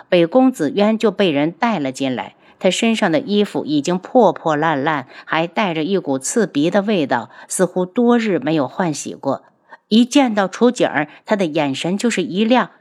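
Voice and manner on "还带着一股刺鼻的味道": ordinary delivery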